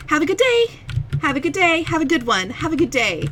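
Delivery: high chirpy clerk, fading down; sing-song shop goodbyes, settling down; customer service voice. Retold or imitated. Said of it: "that's not even my customer service voice".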